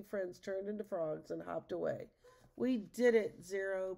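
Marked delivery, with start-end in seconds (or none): 2.6-4.0 loud, lifted cheer; cried in triumph. A narrator's reading. Boy celebrates with his dog after victory, loud happy cry. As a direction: cheer it out, loudest at the start. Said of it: "Peter cried".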